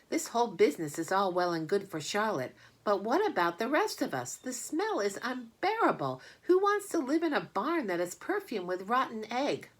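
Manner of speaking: high, complaining lamb; high voiced lamb griping. A narrator's reading. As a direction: whine about the smell.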